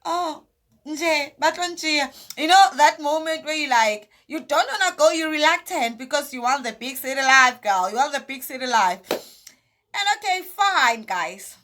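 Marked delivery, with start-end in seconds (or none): 0.0-1.9 reluctant, higher sigh of a no. Retold or imitated. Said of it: "I was like". 9.1-11.6 slow, drawn-out giving in; resigned. Real, not acted.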